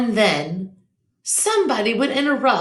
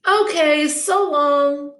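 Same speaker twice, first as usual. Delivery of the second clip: high, louder cheery goodbye; cheerful; 7 semitones higher, 4 dB louder, slower than usual. Acted. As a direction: sing out a breezy farewell. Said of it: "said Rosie cheerfully".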